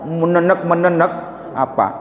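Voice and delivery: normal talking voice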